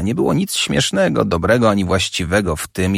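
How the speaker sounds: ordinary delivery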